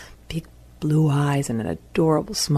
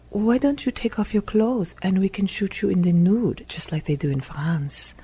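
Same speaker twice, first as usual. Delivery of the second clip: put-on higher-pitched French accent; playful invitation in a French accent; sexy French accent; 5 semitones higher than usual. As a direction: voice a flirty French woman's accent. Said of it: "that sexy French accent drove us wild".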